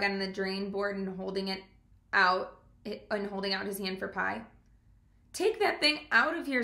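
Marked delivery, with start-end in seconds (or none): 5.3-6.6 higher, a touch of a scolding aunt; Mrs Zuckerman's voice. A narrator's reading. Aunt scolding the boy about the frog, higher and mildly sharp. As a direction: shoo that frog away. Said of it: "said Missus Zuckerman".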